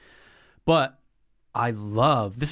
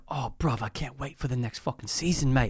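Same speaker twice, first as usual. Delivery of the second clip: mock British fan; 6 dB quieter than usual. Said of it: "I go".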